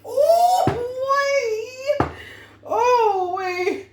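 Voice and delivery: high, loud whooping through laughter; real laughing fit. Real, not acted. Reader still laughing at her joke, whooping high and loud.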